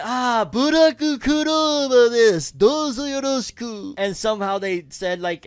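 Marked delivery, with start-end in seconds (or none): 0.0-3.9 high, loud, slow hammed-up polite self-introduction; mock Japanese formal greeting. 高く大げさな丁寧語の物まね. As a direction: executives announcing the movie, in a high hammy bow-and-greet voice. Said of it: "it was like".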